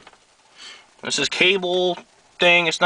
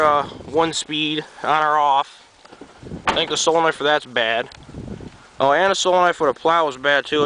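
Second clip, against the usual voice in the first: ordinary talk, nothing marked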